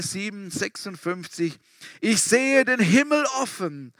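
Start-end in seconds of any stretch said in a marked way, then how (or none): 2.0-3.9 raised, higher cry of a martyr; calling out. Acted. Stephanus calls out to the crowd while being stoned, raised voice. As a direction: call it out toward heaven. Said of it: "rief Stephanus".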